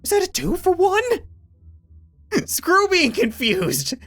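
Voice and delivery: excited disbelief. Real, not acted. Excited reaction to battle.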